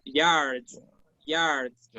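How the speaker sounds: "yards" with the final ds leaned on like a Z; "yards" as a model for the Z sound; voiced DS ending. Shown as a demonstration. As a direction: finish "yards" on a buzzy ds. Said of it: "el sonido de la D S es similar al de la Z".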